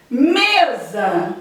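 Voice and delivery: Mesa with a long, strong first syllable me; teacher's demo of Mesa, leaning on me; stress on ME. Shown as a demonstration. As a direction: stretch the opening me of Mesa. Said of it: "Me é a sílaba tônica".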